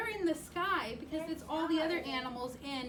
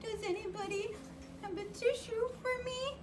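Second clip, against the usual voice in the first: pitiful little flea; 6 semitones higher, 5 dB quieter, faster than usual. Acted. Flea asks for tissue.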